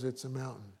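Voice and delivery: ordinary talk, nothing marked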